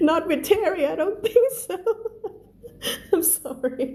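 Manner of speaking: high-pitched talk through real giggles; real laughter squeezing the voice high; laughing while talking. Real, not acted. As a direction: giggle helplessly through the words. Breaks up laughing at own joke.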